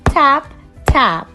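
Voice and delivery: ordinary delivery, nothing marked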